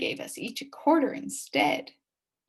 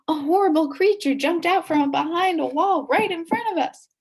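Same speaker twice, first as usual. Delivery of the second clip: louder and much higher as the monster springs out; dramatic reveal; 9 semitones higher, 6 dB louder than usual. A narrator's reading. Narrator revealing the Halloween monster to listeners, dramatic. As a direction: build to a thrilling cliffhanger shriek.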